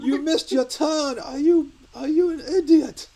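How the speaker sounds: hammed-up furious chef shout, very high and loud; Gordon Ramsay. Chef as sat-nav berating a driver, shouted impression. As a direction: berate the driver at full mock fury, drawn out. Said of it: "Gordon Ramsay telling me how to drive".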